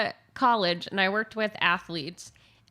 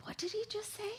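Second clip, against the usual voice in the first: high, hushed mock bafflement; puzzled reaction; 12 semitones higher, 14 dB quieter, faster than usual. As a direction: softly squeak it in puzzled disbelief. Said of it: "you're like".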